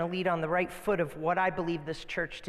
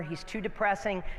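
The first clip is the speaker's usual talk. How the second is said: ordinary talk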